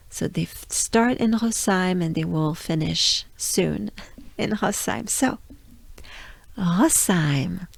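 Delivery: usual speaking voice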